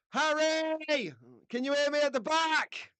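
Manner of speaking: mock calling out to someone hard of hearing; speaking up. Host to half-deaf Harry at the back, calling out high. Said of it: "try and speak up a bit".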